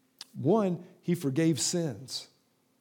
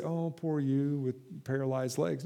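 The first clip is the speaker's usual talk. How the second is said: softer, slower pitying tone; mock pity; 3 semitones lower, 7 dB quieter than usual. What Jesus did not say, mock pity. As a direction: feign a sorry sigh for him.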